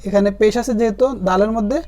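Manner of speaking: ordinary talk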